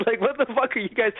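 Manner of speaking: ordinary talk, nothing marked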